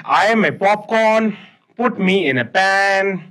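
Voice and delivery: slow sing-song popcorn rhyme; reading a rhyme. Shown as a demonstration. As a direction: read the popcorn rhyme to children. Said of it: "read it aloud and enjoy".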